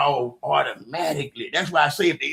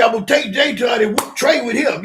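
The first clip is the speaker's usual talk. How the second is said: retold loud threat; 7 semitones higher, 7 dB louder than usual. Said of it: "Then he said".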